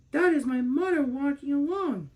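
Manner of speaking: a small bear sure he's found his mother; little bear cub's voice. A narrator's reading. Little bear thinks hopefully.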